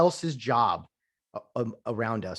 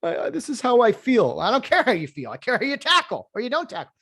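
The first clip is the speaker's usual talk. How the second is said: loud, higher, mocking tough coach; 11 semitones higher, 5 dB louder than usual. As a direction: bark it like a fed-up coach. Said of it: "Just, you know".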